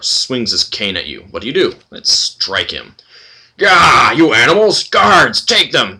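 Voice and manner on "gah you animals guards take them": loud, high roar at the heroes; furious shout. Acted. Old minotaur shouts for guards to seize the party, enraged. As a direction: call the guards in a rage.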